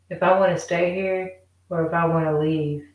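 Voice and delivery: ordinary talk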